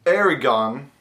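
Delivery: "Aragon" with a stretched, stressed final GON; the outsiders' wrong "Aragon"; mispronounced state name. Shown as a demonstration. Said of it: "it is not pronounced".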